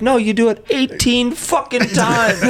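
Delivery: very high, slow, laughing exasperation; laughing comic rant. Retold or imitated. The Scot explaining eighteen holes, very high laughing rant. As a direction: stretch it out high while cracking up. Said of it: "and he's like".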